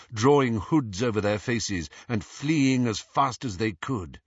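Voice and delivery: usual speaking voice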